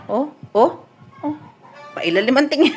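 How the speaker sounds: ordinary talk, nothing marked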